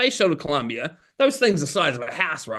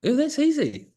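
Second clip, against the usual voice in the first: mock realization; 11 semitones higher than usual. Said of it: "And he just went".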